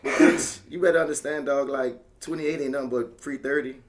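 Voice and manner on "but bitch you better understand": usual speaking voice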